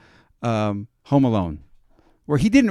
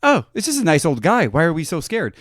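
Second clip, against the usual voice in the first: realizing; 5 semitones higher, 4 dB louder than usual. Retold or imitated. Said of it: "we're like".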